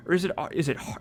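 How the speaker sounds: usual speaking voice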